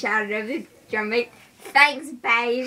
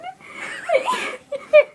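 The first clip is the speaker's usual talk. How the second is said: very high, loud giggle; laughing at her own joke; squealing laugh; 4 dB louder than usual. Real, not acted. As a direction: break into a shrill laugh.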